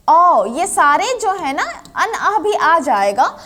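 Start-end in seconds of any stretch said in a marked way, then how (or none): none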